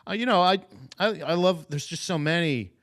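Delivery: hammed-up celebrity lost for words; fumbling guest.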